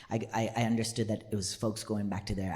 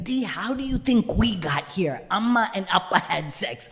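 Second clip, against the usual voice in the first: higher, louder incredulous explaining; acted, raised-voice exasperation; exasperated big sister; 7 semitones higher, 6 dB louder than usual.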